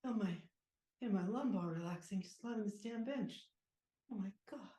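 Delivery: hushed, sinking sighs of relief; soft relief. Acted. Player eases a sore back on the bench, soft relief. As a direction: let it out softly, back easing.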